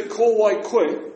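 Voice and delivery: Ko wai koe said slow and high; drawn out like a challenge; challenging. Shown as a demonstration. Teacher showing the rude way to ask. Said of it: "Who do you think you are".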